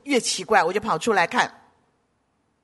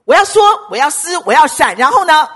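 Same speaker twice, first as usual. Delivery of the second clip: raised, high, emphatic declaring; loud declaration; 5 semitones higher, 10 dB louder than usual. Real, not acted. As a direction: shout out your resolve. Preacher declares fight against spirit. (高声宣告)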